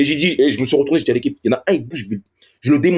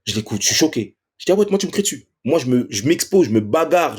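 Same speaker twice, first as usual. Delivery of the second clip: normal talking voice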